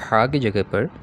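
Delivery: normal talking voice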